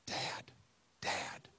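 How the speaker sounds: soft, hushed call to wake Dad; gentle first try. Retold or imitated. Daughter trying to wake her sleeping dad, softly. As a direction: imitate a careful, quiet wake-up call. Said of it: "So I always start off with".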